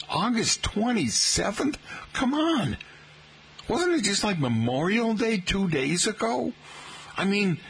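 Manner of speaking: can't believe the date has come so fast; radio host in real disbelief at the calendar. Real, not acted. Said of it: "I can't believe it".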